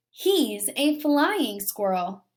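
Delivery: usual speaking voice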